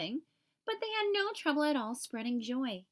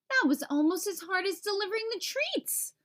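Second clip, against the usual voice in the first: higher-pitched grumpy bunny grumbling; complaining; 7 semitones higher, 3 dB louder than usual. A narrator's reading. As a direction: whine it like a tired, grumpy bunny. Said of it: "Hopper complained".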